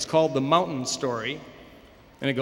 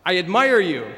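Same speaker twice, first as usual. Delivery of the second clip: loud, projected call; shout to mountain; 3 semitones higher, 7 dB louder than usual. Acted. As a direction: call out praise across a valley. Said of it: "he screams to the mountain".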